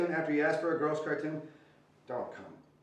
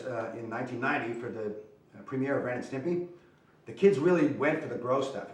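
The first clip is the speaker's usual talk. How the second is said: ordinary delivery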